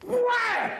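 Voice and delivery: very high, sharp comic "wham"; imitated kick impact. Farmer hauls off and kicks, high imitated impact sound. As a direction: punch out a high-pitched impact noise.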